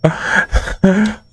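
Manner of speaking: high nervous laugh after a fright; shaky scared laugh. Real, not acted.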